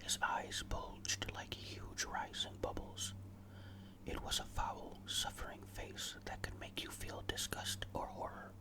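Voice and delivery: ordinary delivery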